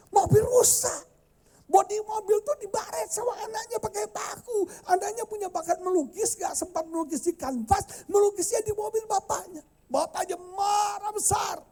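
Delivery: high, loud storytelling shout; dramatic retelling. A narrator's reading. Preacher telling the congregation about the scratched car, dramatic. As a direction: build the outrage of the story.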